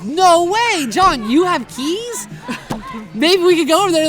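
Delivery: high, loud, laughing mock amazement; delighted fake surprise; excited disbelief. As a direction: act thrilled he has the keys. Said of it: "I was like".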